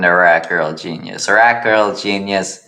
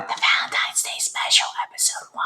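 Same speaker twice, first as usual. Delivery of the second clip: whisper; 9 dB quieter, faster than usual. Acted. Character whispers an aside.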